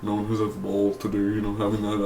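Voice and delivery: half-hearted Joe impression that gives up mid-line. Reviewer trying Joe's pitch, quietly losing it. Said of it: "uh, Joe voice".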